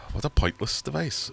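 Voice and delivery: scoffing at the clip-on fan; mock scorn. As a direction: sneer at the gadget. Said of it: "And I was just like".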